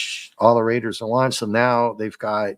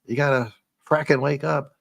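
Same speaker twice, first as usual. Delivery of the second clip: higher, pleading voice shaking him awake; mild urgent plea, pitched up; urgent wife; 6 semitones higher than usual. Retold or imitated. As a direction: grab him and beg him to wake up. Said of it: "she grabs him and say".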